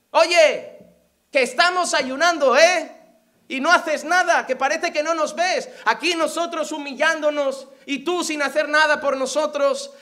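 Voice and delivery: loud, indignant protest to God. Acted. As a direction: raise your voice in mock grievance. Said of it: "levantan su voz y dicen".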